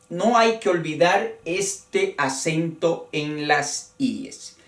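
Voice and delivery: ordinary talk, nothing marked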